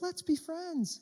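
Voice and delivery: high, sweet talking-doll voice; squeaky toy doll chirping; pull string toy. Pretend. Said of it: "you pull the string, it says".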